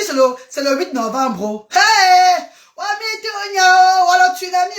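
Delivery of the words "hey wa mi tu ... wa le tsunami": high, drawn-out cry of tsunami warning; mock alarm wail. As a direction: wail the alert in one long high call. (cri d'alerte aigu, traînant)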